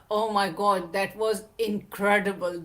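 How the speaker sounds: ordinary delivery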